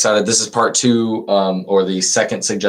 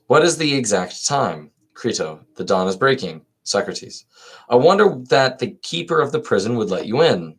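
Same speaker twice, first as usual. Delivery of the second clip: expressive reading, higher lilt on the lines; dialogue read aloud; 4 semitones higher than usual. Acted. Reader voicing Crito and Socrates for listeners, lilting read-aloud.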